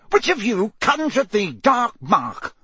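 high, slow, furious demand; snapped accusation. A narrator's reading. Enraged Crouch questions the children, snapped accusation. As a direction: accuse them word by word. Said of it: "he snapped".

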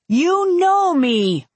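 high, slow, drawn-out call. A narrator's reading. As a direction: call up into the treetops. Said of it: "I called out".

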